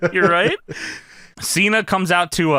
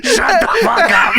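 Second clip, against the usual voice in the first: high, loud, slow mock yell; hammed-up furious boss; Vince McMahon; 5 dB louder than usual. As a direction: holler it slowly, half laughing. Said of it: "And Vince McMahon yells".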